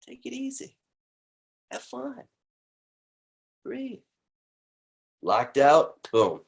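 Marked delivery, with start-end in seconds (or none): 0.1-4.0 very soft, near-breathy higher calm; hushed guidance. Acted. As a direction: let them settle gently. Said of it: "soft closing guidance".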